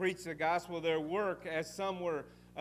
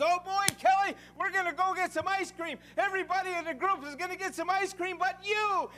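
much higher, louder teasing excitement; exciting voice; 9 dB louder than usual. Retold or imitated. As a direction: hype up a little girl about ice cream. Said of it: "I said it with an exciting voice".

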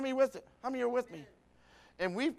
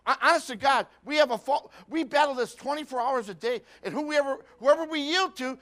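rising, louder preacher's exhortation; preaching; 5 semitones higher, 9 dB louder than usual. Acted. Preacher urging his church to battle their fallen nature, full force. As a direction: drive it home to the congregation.

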